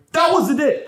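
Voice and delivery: high, loud indignant outburst; girlfriend's mock outraged complaint leaving the movie. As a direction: imitate her storming out annoyed. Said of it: "walked out of Avengers Endgame going".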